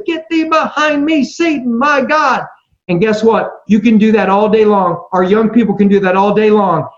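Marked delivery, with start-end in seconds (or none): 0.0-2.5 mock preacher proclaiming. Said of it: "get your preacher voice on".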